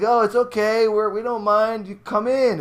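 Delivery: high, loud nervous coaxing; nervous high invitation to the ghost; inviting the ghost. Retold or imitated. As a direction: coax the ghost in, voice way up. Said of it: "talking to the ghost".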